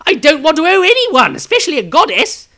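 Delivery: high, loud, quick wry reply; wizard's retort. Acted. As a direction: brush off the priestess with a dry quip. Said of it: "came the wizard's reply".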